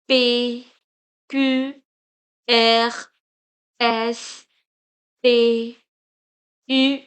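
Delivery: French letter names from H as "ahsh" to Z as "zed", loud; French alphabet. Shown as a demonstration. Teacher reciting for learners, loud French letter names. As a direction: recite H to Z in French, each letter set apart. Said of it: "the pronunciation of the alphabets".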